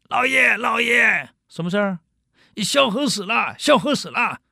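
a servant rushing in to shout the news; excited servant. Acted. Servant bursting in to tell his master, excited and high.

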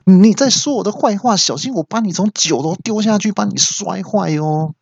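high, heated threat hurled at the phone; mock-furious, threatening the phone; angry yell. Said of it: "我就气到就大叫说".

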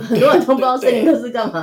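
amused laugh while telling it; giggly real laughter. Real, not acted. Laughing about unknown forestry department.

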